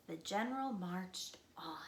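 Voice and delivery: soft whispered line; hushed whisper. A narrator's reading. Narrator after the spider scare, whispering. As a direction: let it fall to a whisper. Quiet after danger passes.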